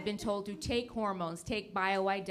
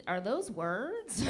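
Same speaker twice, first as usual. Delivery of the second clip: slow confused audience; 3 semitones lower, 5 dB quieter, much slower than usual. Retold or imitated. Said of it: "Others of you are like".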